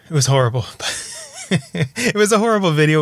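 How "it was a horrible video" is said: laughing through the words, higher. Real, not acted. Vlogger laughing about a silly music video. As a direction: giggle as you say it.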